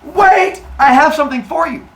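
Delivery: high, loud frantic wait; desperate stall. Acted. Cornered father Uranus stalling his son, high frantic plea. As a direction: stall him in a panic. Uranus pleads at earth's edge.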